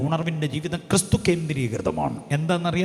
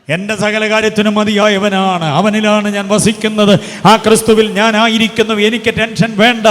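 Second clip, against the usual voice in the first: loud confident declaration; 4 semitones higher, 11 dB louder than usual. Acted. Preacher voicing a believer trusting Christ, loud triumphant declaration. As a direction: declare it at full preaching force, much louder.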